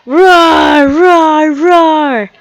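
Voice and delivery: loud, high dinosaur roar; boss roaring. Acted. Dino boss roaring down the phone at the detective, loud roar. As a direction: let out a big beastly roar. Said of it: "I like that our boss roars".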